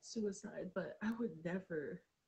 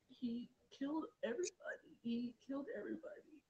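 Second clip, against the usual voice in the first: a repeated stunned whisper; 4 semitones higher than usual. Real, not acted. Grieving sister whispers that her brother's death hurt everyone. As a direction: whisper it twice, almost no voice.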